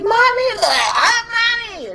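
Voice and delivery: put-on high, drawn-out child's wail; mock-wail for mother; imitated sick daughter. Sick daughter calls for mom while throwing up, high wailed mimicry. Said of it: "When she was throwing up the other day, she called me Mommy".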